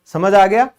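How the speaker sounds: ordinary delivery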